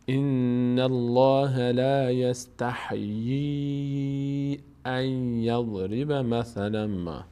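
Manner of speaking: slow chant-like verse, "یستحیی" held long; long madd recitation. Shown as a demonstration. Teacher completes the verse for its meaning, slow recitation. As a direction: recite it all slowly, stretching the yee of "یستحیی".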